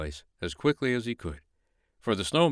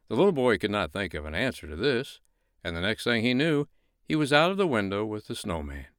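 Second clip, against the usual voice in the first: ordinary delivery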